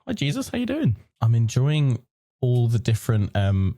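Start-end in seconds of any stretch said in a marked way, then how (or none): 0.1-1.0 playful greeting. Pretend. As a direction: coo hello to the picture.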